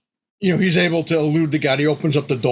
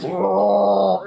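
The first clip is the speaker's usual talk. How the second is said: a mock monster roar in a higher far-off voice; 6 semitones higher than usual. Game master voicing the mad god chasing players, mock roar. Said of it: "the god's like".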